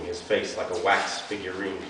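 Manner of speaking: ordinary delivery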